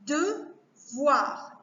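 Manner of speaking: "Devoir" slowly as De... voir; two separate beats in "Devoir"; split into syllables. Shown as a demonstration. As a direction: pause between the syllables of "Devoir". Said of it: "c'est-à-dire deux syllabes".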